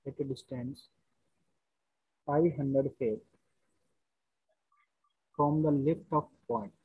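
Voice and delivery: very slow, word by word, repeating phrases; slow dictation. Shown as a demonstration. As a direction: dictate slowly so students can write. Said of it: "question लिखिए पहले यहाँ पे".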